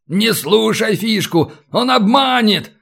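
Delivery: high, pleading wail; desperate howl. A narrator's reading. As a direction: wail a warning in despair. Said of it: "в отчаянии взвыл Катран".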